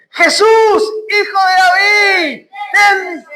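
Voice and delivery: high, drawn-out rallying cry; shouting out. Shown as a demonstration. Preacher leading listeners in the blind man's cry, shouting. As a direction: shout it out and hold the words long. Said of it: "grítenme".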